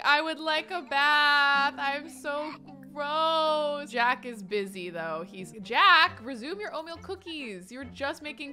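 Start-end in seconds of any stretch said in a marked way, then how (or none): 0.0-3.9 slow, lightly put-on kid voice; voicing a toddler. Owner voices a toddler wanting a bath, mock whiny. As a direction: whine it out like a grumpy toddler. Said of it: "Quinn's like".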